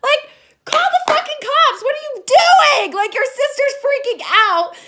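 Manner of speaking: very high, loud disbelief; shrieky exasperated scolding; outraged yell. Pretend. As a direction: yell at the useless kids. Yells at film's kids.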